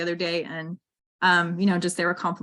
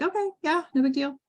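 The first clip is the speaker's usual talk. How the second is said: put-on higher-pitched, casual kid agreement; lightly voiced kids; 8 semitones higher than usual. Mother imitating her daughters' easy agreement.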